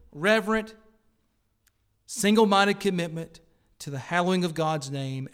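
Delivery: strong pulpit emphasis; preaching at full force. Real, not acted. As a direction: press the warning hard on the congregation. Defining zeal from the pulpit.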